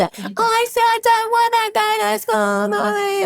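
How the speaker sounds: put-on high, slow whining kid voice; whiny child. A mother imitating her child refusing school, high whine. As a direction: whine like a small child who won't go.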